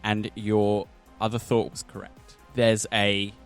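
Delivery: ordinary delivery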